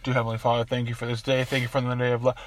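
low, hushed praying teacher; a hint of a kneeling preacher praying; solemn prayer voice. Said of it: "And he goes".

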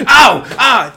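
high, loud laughing yelps; mock yelps of pain. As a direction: yelp as if hurt, half laughing.

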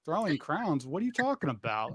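low-key, baffled retort; puzzled reply. Retold or imitated. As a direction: retell a baffled aside. Retells own puzzled reply.

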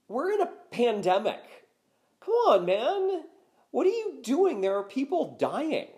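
high, loud, incredulous scolding; indignant pleading. Real, not acted. Host scolding Harden for partying in a pandemic, incredulous and indignant. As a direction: push the pitch way up in exasperated disbelief. Berating an athlete during a pandemic.

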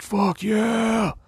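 very high, squealed mock cheer; overjoyed. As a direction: whoop with exaggerated joy, stretching the last word. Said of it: "so happy".

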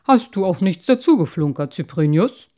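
loud, probing question; big-voiced father checking a tale; merman father. A narrator's reading. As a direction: press the carp sternly but lightly.

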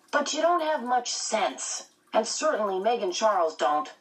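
put-on high boyish teasing voice; teasing twin boy. A narrator's reading. Twin boy teasing his mother about sense, cheeky. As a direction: tease her, a bit cheeky.

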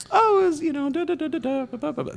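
put-on high, airy girlfriend voice rambling; squeaky girly voice trailing into babble; girl voice. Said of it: "That's my girl voice".

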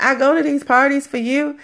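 high-pitched, insistent quoting; retold emphatic husband. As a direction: lightly play a husband making his case. Retold husband pleading his case.

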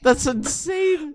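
slow, high-pitched disbelief; amazed. Real, not acted. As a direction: stretch it out, astonished. Amazed by absurd article.

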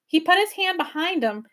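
ordinary delivery

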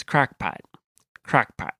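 crockpot said as crackpot, the o flattened to a; Tom Shane accent. Shown as a demonstration. Host showing co-host the crockpot accent, Midwestern vowel. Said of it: "the way that somebody with that accent says the phrase crockpot".

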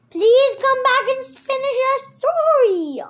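loud, high, calling plea; pleading. A narrator's reading. Alice calls after the departing mouse, pleading. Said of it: "Alice pleaded".

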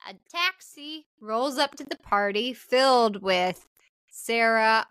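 ordinary talk, nothing marked

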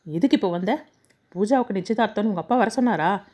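ordinary delivery, nothing marked

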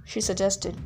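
ordinary delivery, nothing marked

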